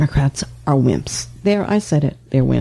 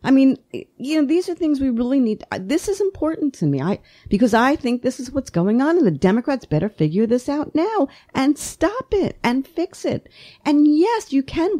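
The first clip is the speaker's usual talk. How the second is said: worked up, voice rising well above normal; voice going up emotionally; 9 semitones higher than usual. Real, not acted. Pundit getting emotional about Democrats, voice rising high. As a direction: pitch climbing high with real emotion. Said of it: "whenever my voice goes up five octaves".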